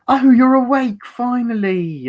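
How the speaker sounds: high, loud British imitation, slowing at the end; British accent. Imitating actress greeting a captive who wakes, British accent. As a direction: sing it out high in mock British, drawing out the last word. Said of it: "she does a great British accent".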